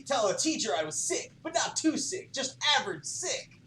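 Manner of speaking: ordinary talk, nothing marked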